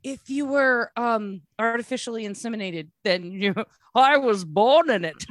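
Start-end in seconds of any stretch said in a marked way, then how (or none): 4.0-5.3 Bane quote. Retold or imitated. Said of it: "repeats Bane line".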